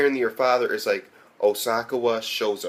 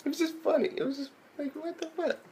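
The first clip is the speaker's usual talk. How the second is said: laughing disbelief; 9 dB quieter than usual. Real, not acted. Laughing at the scene.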